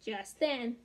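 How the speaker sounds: ordinary delivery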